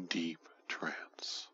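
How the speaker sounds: soft fading whisper. Acted. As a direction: let it sink into a whisper. Whispered trance word.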